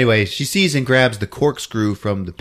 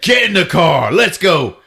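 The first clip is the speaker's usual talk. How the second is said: mock urgent order; 5 semitones higher, 5 dB louder than usual. Rescuer orders her into car.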